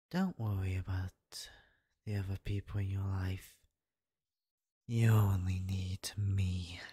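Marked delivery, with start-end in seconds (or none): 4.9-6.8 slow possessive whisper; demonic whisper. Acted. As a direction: whisper it slowly, claiming her.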